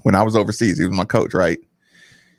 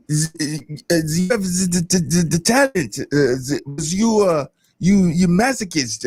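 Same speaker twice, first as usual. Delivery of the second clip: halting, higher-pitched mock Russian accent; imitated Russian accent; 5 semitones higher than usual. Player imitating his overseas Russian coach, halting mock Russian accent. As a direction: stammer through a rough Russian-coach accent that drifts French. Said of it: "I just want y'all to hear the voice".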